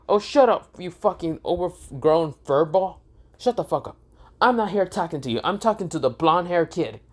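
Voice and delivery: high, heated telling-off of Kurama; angry yelling. Acted. Iten yelling at the fox to shut up, angry. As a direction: snap at him, fed up and insulting.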